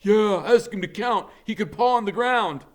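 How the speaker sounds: high, loud mocking jeer; rowdy heckling yell; kids shouting. A narrator's reading. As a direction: taunt him from the back row. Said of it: "kids are shouting stuff like".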